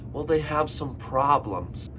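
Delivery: ordinary delivery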